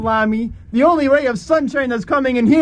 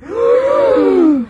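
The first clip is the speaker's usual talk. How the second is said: shocked gasp; 12 semitones higher, 4 dB louder than usual. Acted. Crowd gasps at bomb threat.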